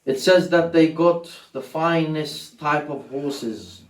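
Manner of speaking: loud, high mournful preaching; impassioned preaching. Acted. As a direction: preach the lament at full force. Preaching at full force.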